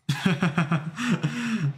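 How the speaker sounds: high real laughter; laughing. Real, not acted. Streamer laughing at being turned to pixels, high laughter. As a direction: burst out laughing, high and amused.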